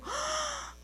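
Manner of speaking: high gasp, then a whispered admission; dawning realization. Retold or imitated. To the taxi driver, a gasped whispered realization. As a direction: it suddenly hits her. Sudden realization about forgiveness.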